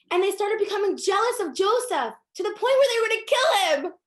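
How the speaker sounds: high, loud preaching at full force, some words slowed; fiery preaching. Acted. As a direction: drive the lesson home like a passionate sermon.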